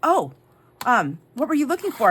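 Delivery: imitating a taken-aback clerk, high and loud; high, loud "oh", then a puzzled question. Clerk caught off guard by her answer, taken aback. Acting out the Costco worker's question.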